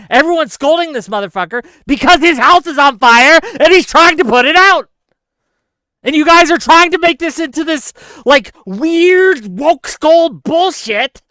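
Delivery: loud, high outraged rant; angry yelling. Real, not acted. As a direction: shout down the scolds in exasperation. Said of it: "I'm so angry at you".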